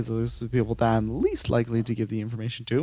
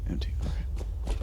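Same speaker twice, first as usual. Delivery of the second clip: whispering; 9 dB quieter than usual. Real, not acted. Player mutters to self.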